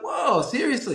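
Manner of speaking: high, awed "whoa"; floored by the news; high amazed retold reaction; impressed disbelief. Amazed at first ten owners.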